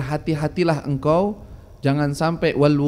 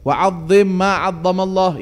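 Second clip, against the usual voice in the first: louder, slow, weighty recitation; slow loud Arabic recitation; 3 semitones higher, 5 dB louder, slower than usual. Acted. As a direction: proclaim the maxim slowly.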